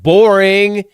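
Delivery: high, loud drawn-out jeer; loud mock heckle. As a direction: call it out in exaggerated boredom. Texting as girlfriend, bored of gentleman.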